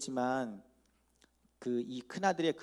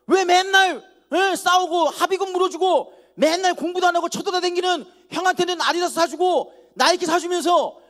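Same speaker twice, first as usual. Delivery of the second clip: shouting back at mother, much louder and higher; talking back; 14 dB louder than usual. Retold or imitated. Teen son yelling back at his mother over sneakers, indignant. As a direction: yell your grievances at your mother. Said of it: "이렇게 대들었어요".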